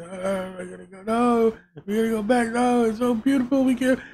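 high, whiny parody of teary heroes; mock epic farewell. Mocking the show's heroes at the jump, whiny high parody. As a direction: ham up the sappy goodbye.

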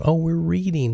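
usual speaking voice

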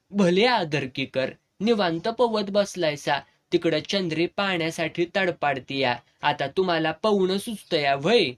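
plain reading voice

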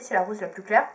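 ordinary talk

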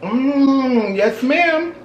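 talking between bites; eating while speaking; mouth full, chewing. Real, not acted. Seen 1: Both eat stuffed peppers; speaker unclear, mouth full mid-bite.

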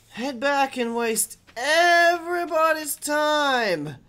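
high, loud, dragged-out complaint; exasperated whine. Real, not acted. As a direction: draw it out slowly in loud, high frustration. Frustrated outburst while playing.

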